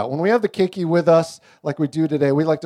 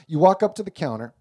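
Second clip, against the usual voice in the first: ordinary talk, nothing marked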